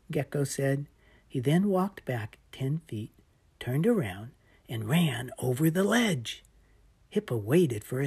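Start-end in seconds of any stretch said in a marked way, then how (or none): none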